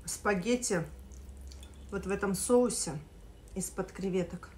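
speaking between bites; talking while chewing. Real, not acted. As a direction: mouth partly full of pasta. Seen 2: Lips pressed and working between words after bite; pasta on fork.

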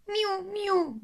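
high meow; a high drawn-out meow; cat meow. Acted. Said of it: "miaula le chat".

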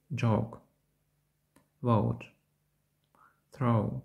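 "Joke", "Vote", "Throw" gliding from between O and E into U; дифтонг оу. Shown as a demonstration. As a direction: lips slightly spread, then rounded on "Joke", "Vote", "Throw". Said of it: "близько до звукосполучення Оу". ("Joke", "Vote", "Throw" з переходом до У)

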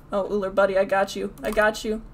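normal talking voice

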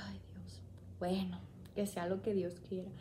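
whispered prayer. Retold or imitated. Settling into the hospital bed, whispering a prayer. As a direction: hush it like a small prayer. Resigned prayer before surgery.

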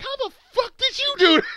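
high, mock-outraged squeak of disbelief; baffled player. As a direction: squeal it like you can't believe the cheat. Voicing baffled player in game.

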